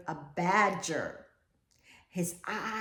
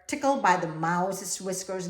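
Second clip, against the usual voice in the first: usual speaking voice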